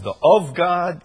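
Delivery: "of" firmly marked off as a quoted word; stress on OF. Shown as a demonstration. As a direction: lean on "of" in "of God". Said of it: "is very emphatic".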